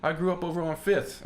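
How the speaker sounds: high-pitched attempt at a Boston accent; bad Boston accent. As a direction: answer as an imitated Boston local. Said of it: "I can't do a good Boston accent".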